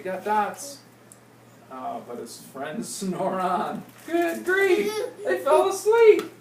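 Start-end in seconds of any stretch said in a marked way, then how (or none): 4.1-6.3 high, loud hammed-up surprise; mock dismay. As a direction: throw up your hands in mock shock. Mock dismay at sleeping friends.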